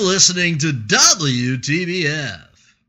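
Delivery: slow, loud station ID; radio reporter voice. Shown as a demonstration. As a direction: read it like a college radio reporter. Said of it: "that was my reporter voice".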